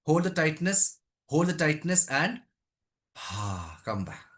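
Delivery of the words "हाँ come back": low, soft letting-go voice; soft release. Real, not acted. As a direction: ease them out of the hold, quietly. Coach releasing the hold.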